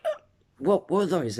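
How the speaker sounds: mock bewilderment from onlookers; puzzled surprise. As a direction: imitate a taken-aback classmate. Acting out astonished classmates' question.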